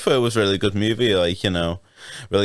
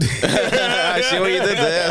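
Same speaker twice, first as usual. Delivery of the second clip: laughing; 8 semitones higher, slower than usual. Real, not acted. Laughing at the pun.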